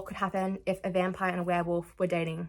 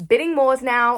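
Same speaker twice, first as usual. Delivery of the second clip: much louder playful announcement; mock auctioneer's shout; 4 semitones higher, 11 dB louder than usual. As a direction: call it out like an auction.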